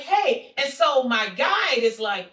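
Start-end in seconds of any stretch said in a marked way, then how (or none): 0.0-0.5 high, loud "hey" to get attention; excited greeting. Retold or imitated. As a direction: call out while waving. Re-enacts waving to a stranger.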